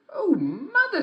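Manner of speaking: put-on very high, girlish and bright; little girl voice. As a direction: play the small girl at the door, loud and high.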